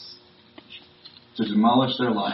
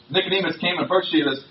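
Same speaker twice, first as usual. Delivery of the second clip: ordinary delivery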